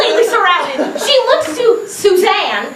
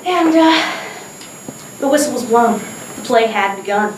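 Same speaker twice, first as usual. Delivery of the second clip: ordinary talk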